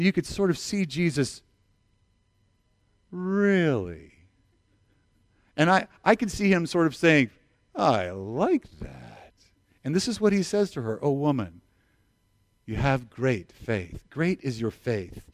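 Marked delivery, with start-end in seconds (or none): 3.1-4.1 amused surprise. Retold or imitated. Imagined Jesus, amused. 7.7-9.3 slow, low, warmly pleased; like savouring a clever reply. Retold or imitated. Imagined Jesus, pleased. 11.0-15.3 slow, soft and low; tender praise. Retold or imitated. Jesus praising the woman.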